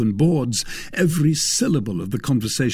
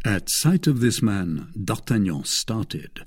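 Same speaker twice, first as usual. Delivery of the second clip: usual speaking voice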